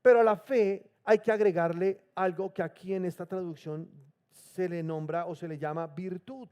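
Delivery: usual speaking voice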